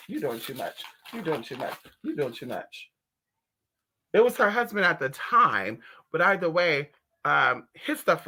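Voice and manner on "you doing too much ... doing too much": soft low aside; quiet scold. Real, not acted. Hushed scolding of someone off-camera, soft and low. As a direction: mutter it at someone nearby.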